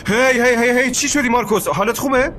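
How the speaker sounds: normal talking voice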